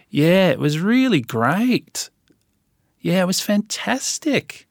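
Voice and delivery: "great" and "fantastic" sung upward, high and drawn out; slow Aussie uptalk, swooping up as if asking on "great" and "fantastic"; Australian rising end. Shown as a demonstration. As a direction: stretch "really great" and "fantastic" and lift them into questions. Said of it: "they sing the end of the sentence".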